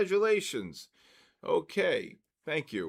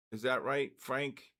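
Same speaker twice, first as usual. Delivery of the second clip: usual speaking voice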